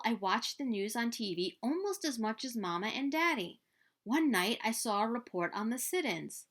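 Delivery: usual speaking voice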